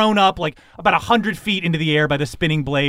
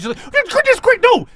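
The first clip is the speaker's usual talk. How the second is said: high, urgent flustered outburst; Ingenue's alarm; 4 dB louder, slower than usual. Acted. Game master voices Ingenue objecting, high alarm. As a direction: cry out in a high voice, building louder. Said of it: "Ingenue is like".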